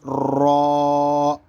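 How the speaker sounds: را rolled thick with rounded lips, vowel held; full, heavy را. Shown as a demonstration. Teacher demonstrating the letter, را full and round. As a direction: roll and round را into a heavy sound. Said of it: "موٹی آواز نکالیں".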